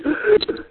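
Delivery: sharp high mock gasp of alarm; retold gasp. Co-host retells the friend's panic, high sharp gasp. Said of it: "And he was like".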